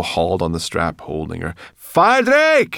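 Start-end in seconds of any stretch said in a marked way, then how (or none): 1.8-2.7 very high, loud urgent call; piercing alarmed hail. A narrator's reading. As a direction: shout up to get attention. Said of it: "she called".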